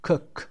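"Cook" with a short clipped u. Shown as a demonstration. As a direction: say "Cook" with a quick short oo as in book. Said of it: "به جز cook که اوی کوتاه".

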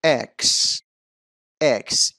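"اکس" as eks, opening with a short e; correct pronunciation. Shown as a demonstration. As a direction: say اکس as EKS, stressed and clear. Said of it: "اشتباه هست".

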